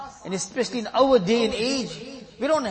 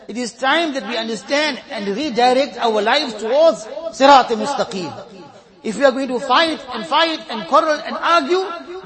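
full-force preaching; 3 semitones higher, 5 dB louder than usual. Real, not acted. Preacher exhorting his beloved ummah, loud and urgent. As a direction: preach it hard to the congregation.

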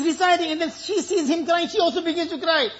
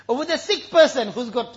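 ordinary delivery

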